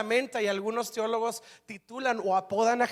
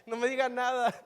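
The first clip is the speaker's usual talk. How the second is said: comic fake sobbing; mock crying. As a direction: wail like a crybaby, for laughs. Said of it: "y él llorando".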